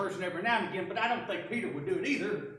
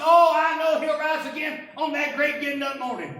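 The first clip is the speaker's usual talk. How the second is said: loud, high, sure of her faith; loud churchy affirmation; fervent declaration; 6 semitones higher, 10 dB louder than usual. Acted. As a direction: proclaim it like a hymn line. Sister answers Jesus fervently.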